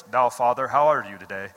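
deep, mock-formal address to God; pompous King James style, pitched low; old English prayer. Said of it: "we use the old English".

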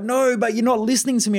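high, put-on voice of the complaining partner; voicing his partner's accusation, pitched high; mock partner voice.

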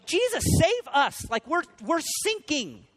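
acted panicked cry for rescue, very high; afraid. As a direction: cry out as if the boat is sinking. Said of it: "I could see just being afraid".

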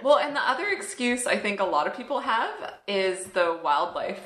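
usual speaking voice

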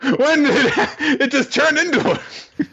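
a very high pitch, breaking into laughter; laughing question. Real, not acted. Host amused by the absurd cream puff, laughing. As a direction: ask while giggling helplessly.

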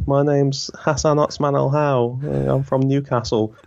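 put-on slow, high, careful little-boy voice; a shy eight-year-old reciting his name to the class; young me introducing myself. New boy introducing himself to classmates, high and slow. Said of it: "And I was like".

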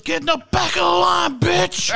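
high, loud mock shout; kid's shout. Man re-enacting the kid's shout at the lady, high shout. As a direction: command it like an angry boy. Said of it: "he goes".

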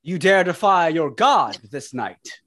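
slow, lordly threat; a hint of the offended god; booming echoing villain monologue.

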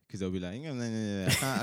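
normal talking voice

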